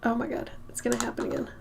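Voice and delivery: quiet, breathy, sneeze coming; about to sneeze. Real, not acted. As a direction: hold off a sneeze.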